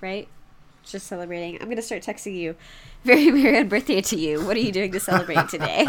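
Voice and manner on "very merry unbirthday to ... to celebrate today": louder, quick, chirpy well-wishing; cheery mock greeting. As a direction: gush a playful celebratory greeting. Acting out a cheery text message.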